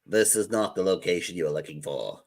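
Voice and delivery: low, soft movie-hero voice; a hammed-up Jedi mind trick; film droid-line impression. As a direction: deliver it like a solemn film mentor. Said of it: "Now do it more Lego-y".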